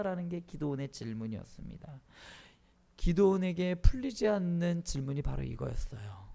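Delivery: normal talking voice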